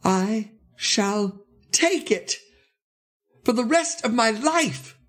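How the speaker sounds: high, drawn-out elated declaration; high, emphatic storybook hero; declaring. A narrator's reading. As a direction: announce it slowly in a high, swelling voice. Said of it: "reported to have said".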